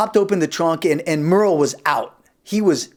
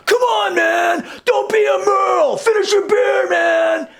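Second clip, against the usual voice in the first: much higher mock shout; yelling; 13 semitones higher, slower than usual. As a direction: yell at a dude at a party. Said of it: "totally yelling at this dude".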